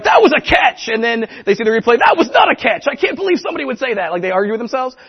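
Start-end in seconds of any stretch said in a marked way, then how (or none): 0.0-0.9 loud, high sports-announcer shout; an announcer sure of the catch, calling it loud; excited announcer call. Retold or imitated. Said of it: "the announcers are like". 2.0-4.1 overturn the call in mock outrage; announcer reversing himself after the replay, indignant and rushed. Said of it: "the announcers are like".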